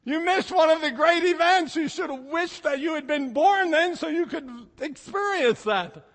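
high, playful reproach; mock scolding. Preacher teasing folks who weren't born then, playfully scolding. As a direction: tease them for missing history.

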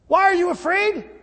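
high, loud incredulous question; indignant mock echo; exasperated. Echoes Jesus' question in exasperation.

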